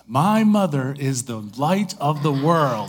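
slow, loud, confident proclamation; gathered up and announcing it; proud declaration. Retold or imitated. Little girl on stage finally saying her line, loud and proud. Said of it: "gathers herself".

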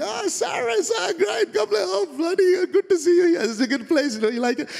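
high, effusive Indian greeting; Indian accent. Acted. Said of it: "you find another Indian".

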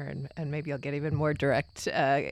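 normal talking voice

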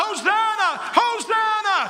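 high, loud joyful cry; children crying out. Acted. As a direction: call it out like worshipping children.

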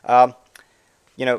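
normal talking voice

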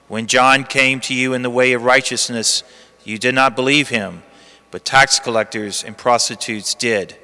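low, hushed Gospel reading; cathedral echo. Acted. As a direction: read the Gospel solemnly, like in church. Liturgical Gospel reading at the lectern.

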